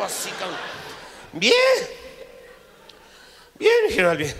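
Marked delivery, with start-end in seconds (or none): 1.3-4.3 nervous high answer. Retold or imitated. Nervous reply to general.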